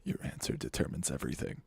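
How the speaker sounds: low, fast, whispery menace; sultry Riddler. Acted. Villain presses a contestant for an answer, sultry whisper. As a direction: hush a threat like a villain. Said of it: "sultry Riddler voice".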